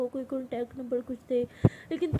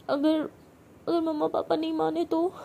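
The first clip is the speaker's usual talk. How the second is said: higher and louder, frightened; panicked worry; 5 semitones higher, 3 dB louder than usual. Retold or imitated. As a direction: stammer out the fear. Panicking before entering home. (ऊँची, घबराई हुई आवाज़ में)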